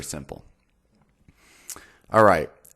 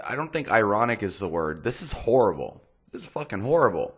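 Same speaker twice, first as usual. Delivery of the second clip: ordinary delivery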